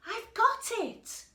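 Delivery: high, loud burst of discovery; excited mouse. Acted. The mouse in bed finding his idea, excited high shout. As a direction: shout it like a sudden bright idea. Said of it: "he said".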